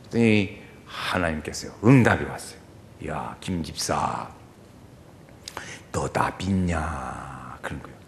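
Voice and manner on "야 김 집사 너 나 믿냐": deep, slow, hushed God answering; low slow voice of God. Retold or imitated. Preacher voicing God low and slow to the deacon.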